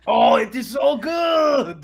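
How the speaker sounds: put-on high, loud, drawn-out Russian accent; mock Russian accent. Quoting the film character on macaroni, mock Russian accent. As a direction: gush slowly in a hammy Russian accent. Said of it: "said Russian".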